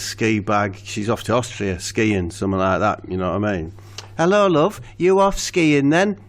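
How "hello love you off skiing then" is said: voicing Steve. Steve greets the big lass.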